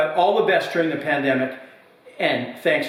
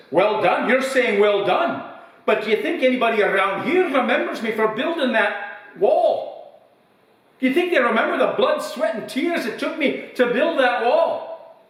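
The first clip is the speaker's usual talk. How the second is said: put-on indignant old man; 10 semitones higher than usual. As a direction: huff at the praise like a bitter old builder. Old man in pub joke.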